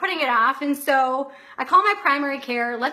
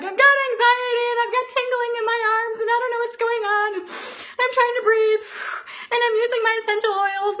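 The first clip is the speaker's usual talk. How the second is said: high, fast, panicky mock sobbing pleas; retold panicked crying; 9 semitones higher, faster than usual. Telling the ER nurse about her tingling, high and panicky. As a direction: rush it out high and frantic, near tears. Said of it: "I start crying".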